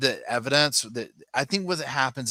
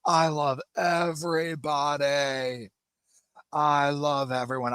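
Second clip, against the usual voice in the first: slow, higher, sing-song mock Lori; mimicking Lori; 6 semitones higher, slower than usual. Lori gushing to Keith, mocked slow and higher. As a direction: draw it out sweetly like a mock Lori. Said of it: "Lori laughs and says".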